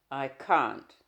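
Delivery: loud, clipped refusal; sharp refusal. Acted. Husband pushing his wife away, loud and sharp. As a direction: bark the refusal out.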